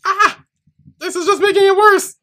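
panicked high yelp; shrieking in panic at the mess; phobic panic. Real, not acted. Spill spreading under her mic.

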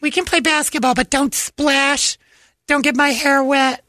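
high, slow whiny falsetto; hammed-up high whining girl; mock fussy girl. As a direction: drag out each fussy demand.

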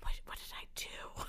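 whispered, mock-wounded self-questioning; hurt whisper. Recounting going home after rude service, hurt whisper.

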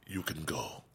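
soft, sad bodyguard voice; dejected mumble. Retold or imitated. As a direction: hang your head and say it softly.

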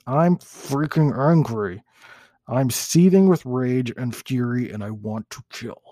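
slow, drawn-out angry monster; a first, half-hearted go at a raging monster. Acted. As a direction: seethe slowly as the monster. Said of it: "That's my monster voice".

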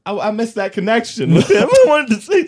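high giggling voice of an imagined woman; laughing mock quote. Woman pining for a park stranger, said through laughter. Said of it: "and she's like".

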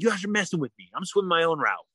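stubborn retort. Retold or imitated. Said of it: "I was like".

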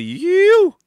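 high, loud mock yell of you; imitating the shout. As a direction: throw out a drunken holler. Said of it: "this one with the".

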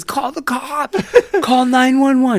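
high, laughing mock panic; panicked guy. Pantsed guy begging his girlfriend, high laughing mock panic. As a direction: squeak it out like a scared man with no pants on. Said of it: "And he says".

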